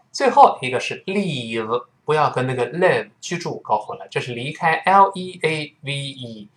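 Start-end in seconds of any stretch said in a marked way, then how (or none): none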